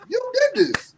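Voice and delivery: high acted blame; high-pitched accusation.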